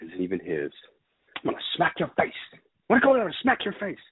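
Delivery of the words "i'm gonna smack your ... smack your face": rising from a hiss to a high angry shout; mock gangster threatening the girl; Lester threatening. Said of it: "Lester talking to the little girl".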